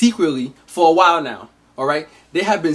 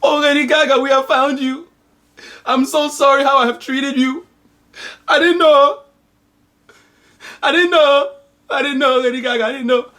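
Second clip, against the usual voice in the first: high, hammed-up weepy mother; mother's voice; 7 semitones higher than usual. Mother apologizing to found daughter, high and hammed-up. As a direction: plead like a sobbing soap mother. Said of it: "the mom is like".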